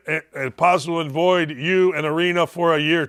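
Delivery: usual speaking voice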